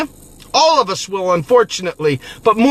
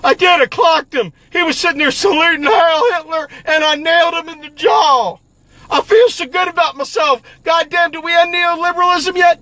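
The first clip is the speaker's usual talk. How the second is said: higher, gloating mock of a smug puncher; smug self-satisfied voice; 5 semitones higher than usual. Mocking a smug activist, higher and gloating. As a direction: play the pleased fool bragging. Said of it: "you and I will be sitting there saying".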